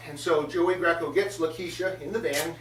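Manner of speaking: ordinary talk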